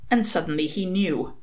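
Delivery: ordinary delivery, nothing marked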